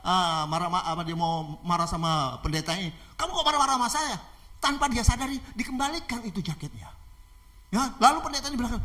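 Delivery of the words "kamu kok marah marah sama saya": high indignant question; acted outburst of offended anger; angry robber. Robber scolding the pastor who defies him, angry. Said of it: "dia bentak-bentak".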